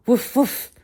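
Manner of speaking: high, loud imitated woofs; happy barks. Dog answering Tiana with happy woofs. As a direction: bark back cheerfully. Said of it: "Stella barked".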